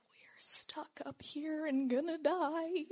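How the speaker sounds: slow, whispered dread; doomed whisper. Retold or imitated. Stuck at the back of the line, a doomed whisper. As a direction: mutter the doom to yourself, slowly. Panicked thought while waiting.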